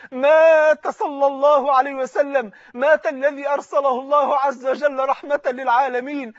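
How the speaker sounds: loud, rising sermon lament, each line struck on مات; impassioned preaching. Acted. Preacher crying out the Prophet's death to the mosque, impassioned sermon. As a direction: preach the grief out to the crowd.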